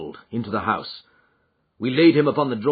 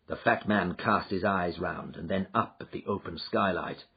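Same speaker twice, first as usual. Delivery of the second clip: ordinary delivery, nothing marked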